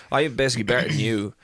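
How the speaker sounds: ordinary delivery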